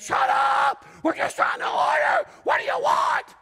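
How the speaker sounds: put-on screamed high and loud at the kids; a dad snapping in the drive-thru, yelling; dad losing it at squabbling kids in the car, shouting; furious dad shout. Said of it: "Dad absolutely lost his mind".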